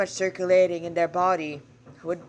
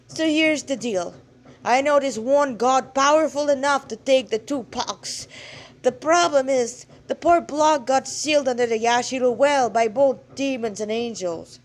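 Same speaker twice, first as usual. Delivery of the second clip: louder, higher blustering god; embarrassed old god; 4 semitones higher, 5 dB louder than usual. Acted. Blushing god admitting his lost power to the girl, louder. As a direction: bluster through an awkward plea.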